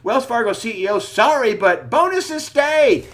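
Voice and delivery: mock the headline as if proudly announcing it; pompous mock reading. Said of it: "Read the pompous headline".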